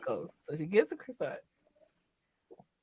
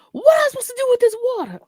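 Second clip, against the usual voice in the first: fast, higher panicked mom voice; flustered mom; 14 semitones higher, 6 dB louder, much faster than usual. Retold or imitated. Mom confused about the pasta water, fast and high. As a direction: rush it as a lost mother. Mom's confused question quoted.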